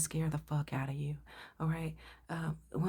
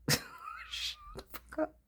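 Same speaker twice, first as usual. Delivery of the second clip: laughing retort; much slower than usual. Real, not acted.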